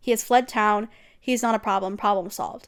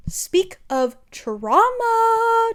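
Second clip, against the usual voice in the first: dramatic high slow cry; 12 semitones higher, 4 dB louder, much slower than usual. Real, not acted. Exclaiming over trauma.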